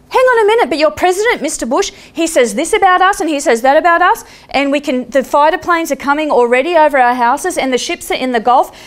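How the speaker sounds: high, urgent complaining; agitated. Retold or imitated. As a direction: rattle off the grievances. Agitated Iraqi man complains.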